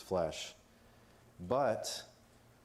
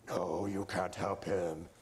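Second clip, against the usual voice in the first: slow, drawn-out demon taking over; slower than usual. Acted. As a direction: put on a possessing demon, dragging the words. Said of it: "when the voices change".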